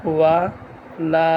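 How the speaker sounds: measured syllable-by-syllable Quran drill; broken-up syllables. Shown as a demonstration. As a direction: recite one syllable, stop, breathe, then the next. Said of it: "ভাঙ্গা ভাঙ্গা উচ্চারণ করব".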